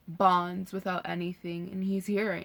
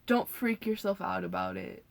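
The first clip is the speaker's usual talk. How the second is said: ordinary talk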